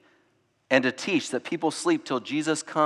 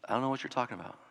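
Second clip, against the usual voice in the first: soft, lower, untroubled answer; calm unknowing reply; 3 semitones lower, 12 dB quieter, much faster than usual. Retold or imitated. The resurrected answering those who ask about the turmoil, softly. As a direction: say it quietly, unbothered. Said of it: "They'll say".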